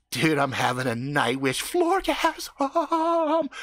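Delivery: slow rapture climbing into a falsetto moan; ecstatic. Retold or imitated. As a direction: stretch the last word into a high, hammed-up climax. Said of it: "with this ecstatic look on his face".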